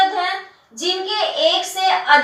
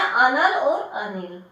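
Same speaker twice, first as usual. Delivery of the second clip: slow contrast; 5 semitones lower, 3 dB quieter, much slower than usual. Shown as a demonstration. Teacher contrasts similar-sounding words.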